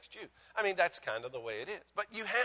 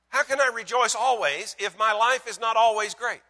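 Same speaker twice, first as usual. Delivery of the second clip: high, insistent questions growing louder; doubter's protest; 6 semitones higher, 10 dB louder than usual. Acted. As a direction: voice an objector pressing for an answer.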